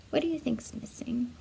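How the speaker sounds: ordinary delivery, nothing marked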